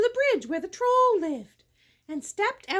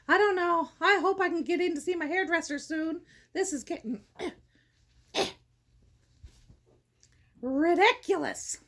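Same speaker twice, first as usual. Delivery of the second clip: ordinary delivery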